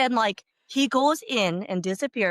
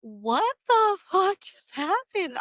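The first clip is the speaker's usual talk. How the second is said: slow, higher mock disbelief; stunned disbelief; 8 semitones higher, much slower than usual. Mom reacting to her husband in the car, slow and baffled. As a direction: drag it out, baffled. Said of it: "I was like".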